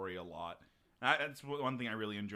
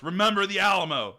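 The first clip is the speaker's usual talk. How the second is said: higher, loud battle cry; yells; 8 semitones higher, 12 dB louder than usual. A narrator's reading. Texan at the plane door, loud punchline yell. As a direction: belt it out like a war cry. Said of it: "the Texan goes to the door and yells".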